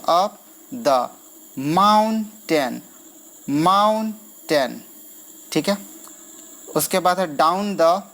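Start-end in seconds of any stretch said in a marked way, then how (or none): 0.0-1.1 "Up" held long, "the" dropped lower; stress on up. Shown as a demonstration. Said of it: "ये आपको थोड़ा सा time लेगा". 1.7-4.8 "mountain" stretched in two beats, first beat high; syllable-by-syllable scansion. Shown as a demonstration. Teacher scanning syllables slowly.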